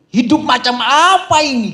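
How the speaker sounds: hammed-up complaint of a man with no coffee; complaining. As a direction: moan like life has betrayed you. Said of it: "dia ngeluh".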